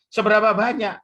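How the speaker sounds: loud, high follow-up question; mock interrogation. Friend questions the demon further, loud mock interrogation. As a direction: press the demon for an answer, loudly.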